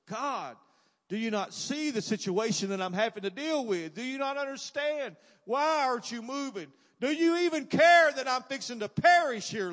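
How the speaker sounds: high, desperate plea building to a shout; anguished, high-pitched outcry in re-enacted prayer; crying out to God. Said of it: "I cried out to the Lord".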